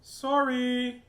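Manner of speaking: high, stretched-out "sorry"; singsong apology. Acted. Helen to the wolf she sprayed, high singsong apology. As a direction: draw it out high, like an oops.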